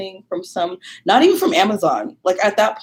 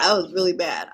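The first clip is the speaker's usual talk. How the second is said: ordinary talk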